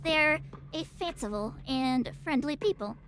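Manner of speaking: normal talking voice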